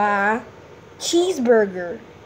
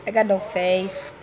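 usual speaking voice

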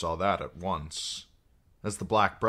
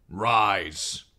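a louder clipped royal order; stern king's command; 3 semitones higher, 6 dB louder than usual. Acted. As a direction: command them to stand. King commands kneeling men.

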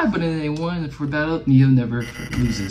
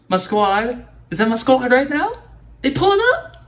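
climbing higher with each question; hopeful disbelief building up; rising excitement; 12 semitones higher than usual. Real, not acted. Hoping his squad arrived.

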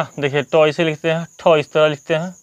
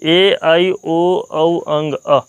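slow letter recital; much slower than usual. Shown as a demonstration. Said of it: "second method से देखिए".

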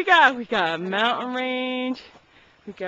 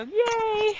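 excited; 9 semitones higher than usual. Real, not acted. Cheering at campsite.

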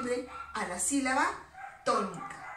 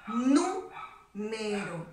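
Número drawn out very slowly, syllable by syllable, with nú leaned on; teacher showing the stressed syllable in Número; 4 semitones lower, much slower than usual. Shown as a demonstration. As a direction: spell out nú-me-ro slowly and hit nú. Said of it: "la mayor fuerza de voz va a recaer en aquella que tiene tilde".

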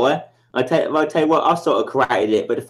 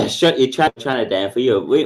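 ordinary delivery

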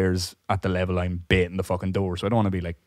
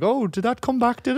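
much higher, sly mock innocent voice; mock fake caller. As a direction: play the prank caller asking innocently. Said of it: "be like".

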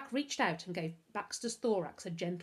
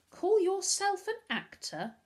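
high, slow, louder taunt; taunting challenge; 13 semitones higher, 6 dB louder, slower than usual. A narrator's reading. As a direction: goad someone into performing. Boy taunts girl about acting.

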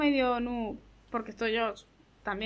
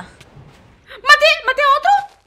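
much higher, loud eager question; excited disbelief; 10 semitones higher, 8 dB louder, much slower than usual. Real, not acted. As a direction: ask hopefully, thrilled. Excited asking.

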